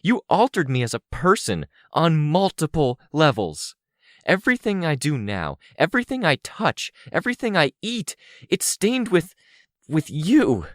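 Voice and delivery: high-pitched angry tirade, building; harsh, fervent anger. A narrator's reading. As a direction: let the accusations pour out with rising fervor. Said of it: "so I spoke with more fervor, more harshness in my voice".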